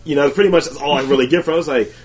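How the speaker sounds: ordinary talk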